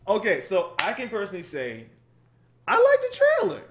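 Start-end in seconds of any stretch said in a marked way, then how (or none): 2.7-3.6 high, loud enthusiastic approval; declaring it with delight; emphatic verdict. Real, not acted. Verdict on trailer.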